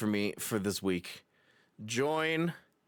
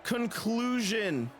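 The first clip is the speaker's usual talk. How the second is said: wrestling commentator; 8 semitones higher than usual. Acted. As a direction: let the result sink in. Wrapping up the match.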